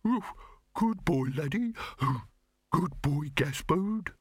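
high, feeble talking-dog yips; faint doggy voice with little woofs; faintest barks. A narrator's reading. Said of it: "managed the faintest of barks".

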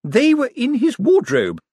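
insist, offended; Miss Drapes. Acted. Miss Drapes revealing where the ledgers were, indignant.

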